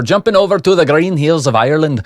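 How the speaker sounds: a light, hopeful attempt at an Irish lilt; Irish accent. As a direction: try out an Irish brogue. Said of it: "Is that pretty good?".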